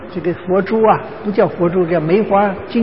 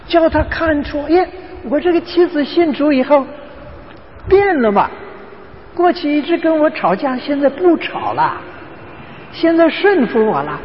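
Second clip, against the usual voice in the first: voiced higher, an impressed husband noticing the change; pleased husband; 8 semitones higher than usual. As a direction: play the husband, pleased his wife changed. Preacher voices the unbelieving husband.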